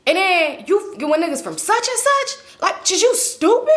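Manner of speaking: high, incredulous mock scolding; going in on her. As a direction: grill her as if she were stupid. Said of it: "he really going in on her".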